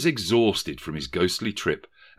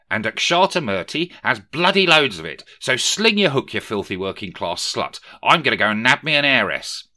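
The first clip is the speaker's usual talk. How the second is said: higher, louder, crude dismissal; sneering young Scrooge; 4 semitones higher, 7 dB louder than usual. A narrator's reading. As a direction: dump her with posh contempt. Young Scrooge dumps Belle.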